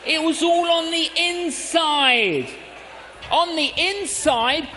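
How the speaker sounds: emphatic on the inside; emphatic slow. Shown as a demonstration. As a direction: drive the point home slowly. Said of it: "I didn't let it all out".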